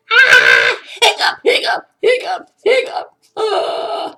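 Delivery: loud, high mock shrieks with hiccups; hammed-up scream. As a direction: scream in pretend fright, hiccuping between cries. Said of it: "And the skeleton screamed".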